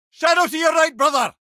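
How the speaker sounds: loud, high urgent yell; combat warning shout. Acted. Warrior head warns his brother of a shadow, loud urgent shout. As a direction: bellow it across the battlefield.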